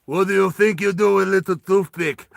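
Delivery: big, loud Russian tough; Russian accent. Acted. Scarred Russian mocks Roy's throwing knives, loud Russian accent. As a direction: taunt in a thick Russian accent. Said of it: "Oh, wait, he's Russian".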